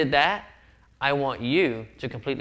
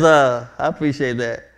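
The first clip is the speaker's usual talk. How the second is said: mock gracious reply; 6 dB louder than usual. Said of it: "So he says".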